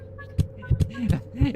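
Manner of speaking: high, bursting laugh; real laugh. Real, not acted. Streamer laughing at chat's joke, high burst of laughter. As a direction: cracks up at chat.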